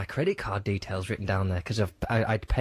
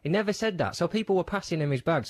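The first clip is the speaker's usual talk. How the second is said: usual speaking voice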